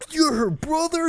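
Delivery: high, shocked question. Retold or imitated. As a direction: ask it in disbelief. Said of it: "they're like".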